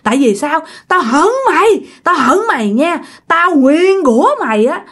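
high-pitched vengeful cursing; acted spirit's furious curse; fierce threats. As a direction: spit out hatred as a vengeful ghost. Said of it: "Làm rất là hung dữ".

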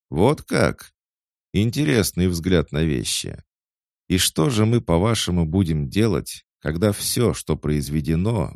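snooty cat voice. A narrator's reading. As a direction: question him with cool irony. Cat answers ironically.

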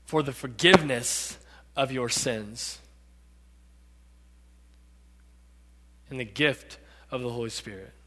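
low, soft voice with long pauses; solemn answer. Acted. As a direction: give each phrase quietly, room to settle. Peter's solemn answer to crowd.

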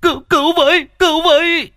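loud, very high terrified shriek; shrieking for help. Acted. Beggar sees the car rushing at him, shrieking for help. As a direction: scream for rescue, stammering. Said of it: "Nó cũng rú lên".

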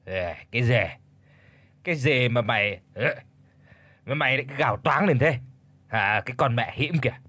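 put-on low, slurred drunken mumble; drunk slurring. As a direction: slur the words like a drunk old man. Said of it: "cất cái giọng lè nhè toàn mùi men rượu". (giọng lè nhè say rượu)

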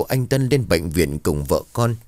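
ordinary delivery

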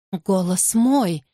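plain reading voice

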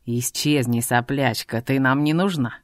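low, cold threat; menacing thug. A narrator's reading. Bearded thug tells the girl to vanish, low and menacing. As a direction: growl at her, dismissive.

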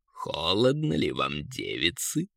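low, slow, soft taunt; taunting eagle. A narrator's reading. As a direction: repeat the tease deep and unhurried. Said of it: "опять повторил Эрвин". (низкий насмешливый голос орла)

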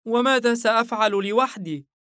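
put-on high, loud child's voice; small boy protesting nervously; flustered little boy. A narrator's reading. Son afraid of being left alone, flustered child voice. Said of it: "آش شابكا يده بارتباك".

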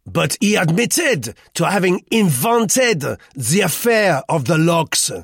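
loud, high taunt; jeering. A narrator's reading. Roux accuses Duclos of invention, jeering. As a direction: crow over the admission, jeering loudly. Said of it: "he jeered".

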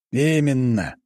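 satisfied, high-pitched exclamation; pleased exclamation. A narrator's reading. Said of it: "довольно воскликнул граф".

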